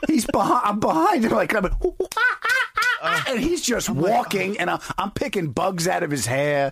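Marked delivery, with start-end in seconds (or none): 1.6-3.2 high, hooting chimp calls; chimp noises. Retold or imitated. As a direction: hoot like a monkey behind him. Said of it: "walk like a chimp".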